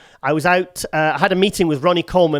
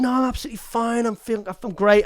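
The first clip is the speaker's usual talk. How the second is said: fake cheerful; 6 semitones higher than usual. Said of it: "Total lie".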